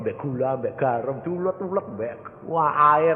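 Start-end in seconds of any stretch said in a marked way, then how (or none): none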